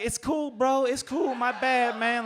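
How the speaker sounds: very high-pitched, placating apology; sheepish appeasing. Retold or imitated. Drunk passenger patting cop's shoulder, appeasing.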